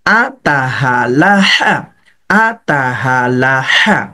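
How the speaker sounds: very slow, drawn-out আতাহা Laha; slow syllable drill. Shown as a demonstration. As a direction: stretch each syllable of আতাহা Laha slowly. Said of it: "উচ্চারণগুলো কিভাবে করবেন". (আতাহা লাহা খুব ধীরে টেনে)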